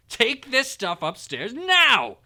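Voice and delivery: loud, high, slow command; angry order. A narrator's reading. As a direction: bark it at the boy. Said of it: "snapped his uncle".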